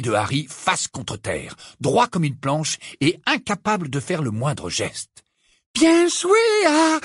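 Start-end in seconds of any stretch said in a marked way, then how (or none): 5.7-7.0 very high, exultant cry; excited praise. A narrator's reading. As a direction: cheer out a comrade mid-battle. Praise shouted mid-battle. (félicitations criées, très aiguës)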